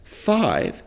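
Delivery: "five" pitched high, as if with lifted eyebrows; high intonation. Shown as a demonstration. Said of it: "make your eyebrows lift up whenever you say".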